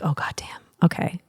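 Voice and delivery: a soft voiceless "God damn", then a resigned okay; whispered curse. Retold or imitated. Teased partner gives in with a whispered curse. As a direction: whisper it, defeated and frustrated.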